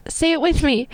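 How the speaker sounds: mock friend's voice. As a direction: lightly imitate her cheering you on. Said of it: "she's like".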